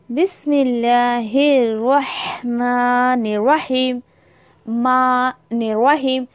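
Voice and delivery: slow बिस्मिल्लाहिर्रहमानिर्रहीम, nun merged into ra; careful recitation. Shown as a demonstration. Teacher reciting for students to repeat, slow and clear. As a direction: recite slowly, joining मानिर्रहीम smoothly. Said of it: "नून को फ़ौरन उठा के रॉ के साथ मिलाना है".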